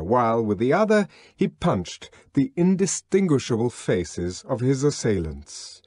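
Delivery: ordinary delivery, nothing marked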